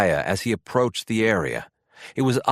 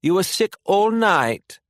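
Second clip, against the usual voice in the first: British mother, pitched higher and slow, scolding; a firm British mum saying no; British mother refusing her son sausages, high and slow; strong British accent; 10 semitones higher, 3 dB louder than usual. A narrator's reading. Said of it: "in a strong British accent".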